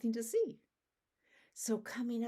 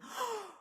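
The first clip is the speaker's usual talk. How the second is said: sharp, high gasp of alarm; shocked gasp. Acted. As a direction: gasp as if a man is missing. Count comes up one short.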